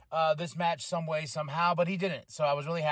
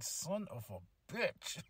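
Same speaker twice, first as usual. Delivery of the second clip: muted exasperation; 12 dB quieter, much slower than usual. Retold or imitated. Retells his annoyed reaction.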